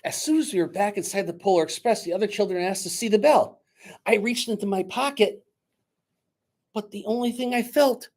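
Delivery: lively read-aloud narration; storyteller reading a Christmas book aloud; story narration. A narrator's reading. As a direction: tell it to a room of children. Reads story aloud to children.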